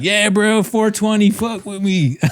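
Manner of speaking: high-pitched, over-eager bro voice; mock stoner hype. Imagined chummy reply to the stoner comic, high mock hype.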